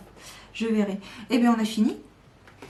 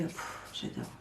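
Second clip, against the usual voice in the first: breathless whisper; 4 semitones lower, 12 dB quieter than usual. Real, not acted. Overwhelmed by her favourite card.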